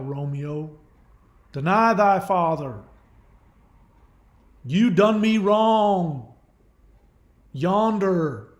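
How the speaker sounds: put-on broad hillbilly accent, theatrical recital; hillbilly Shakespeare. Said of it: "give Shakespeare to a hillbilly".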